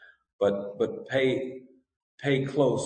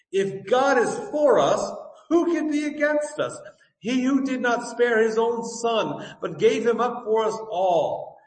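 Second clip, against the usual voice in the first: raised, ringing scripture read, higher and louder; 8 semitones higher, 3 dB louder than usual. Acted. Pastor reading Romans to the congregation, fervent proclamation. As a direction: proclaim the passage to the whole church.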